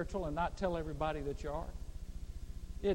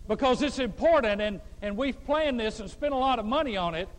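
loud, higher mock prayer that's really a notice; praying man making an announcement; 6 semitones higher, 6 dB louder than usual. Pastor mimics a churchman announcing a class in prayer, loud and mocking. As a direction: boom out the meeting details as if to God. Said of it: "He's making an announcement".

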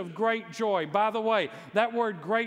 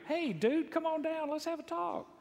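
casual buddy angel; 10 semitones higher than usual. Pretend. Casual angel greeting imagined.

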